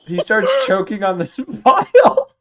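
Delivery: loud, slow, laughing through the words; laughing narration. A narrator's reading. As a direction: narrate while cracking up.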